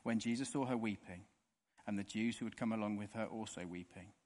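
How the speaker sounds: measured, steady Bible reading; scripture reading. Acted. Preacher reading John to the congregation, steady scripture reading. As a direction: read the passage aloud to the congregation.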